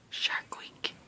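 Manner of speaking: awed hushed whisper; excited whisper. Real, not acted. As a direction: whisper it in disbelief. Excited about scale drop.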